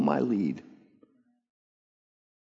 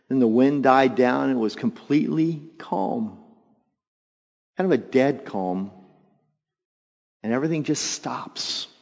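ordinary talk, nothing marked

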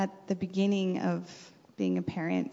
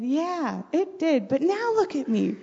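put-on higher, proud child voice, slow at the end; be the kid showing off her swimming; imitating daughter; 7 semitones higher, 4 dB louder, slower than usual.

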